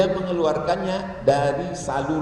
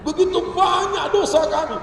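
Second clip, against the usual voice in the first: very high hammed-up lament; mock tearful prayer; 12 semitones higher than usual. Preacher tries to move crowd to tears, mock lament. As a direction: wail the confession theatrically. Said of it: "Saya tes".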